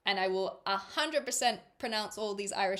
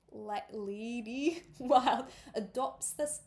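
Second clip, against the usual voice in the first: slow, giggly accent gone Scottish; Scottish slip; 2 semitones higher, slower than usual. Shown as a demonstration. Reader missing the Irish accent, slow slip into Scottish. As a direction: aim for Irish, land in Scottish on "adopts". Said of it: "no, that was Scottish".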